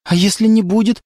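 hopeful rising question. A narrator's reading. The girl asks hopefully if her father will back off. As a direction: ask it with hope. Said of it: "прозвучала надежда".

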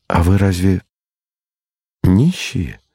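hesitant, mildly crestfallen question; pause, then ask it with a sinking heart; fallen voice. A narrator's reading. Boy asks his friend if they are beggars, crestfallen. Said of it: "спросил я упавшим голосом".

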